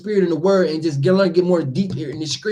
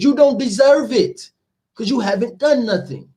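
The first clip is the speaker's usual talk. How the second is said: higher-pitched, emphatic rebuke; scolding rejection; 5 semitones higher than usual. Acted. The Lord refusing help to sinners, emphatic scolding. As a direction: tell them off as unworthy.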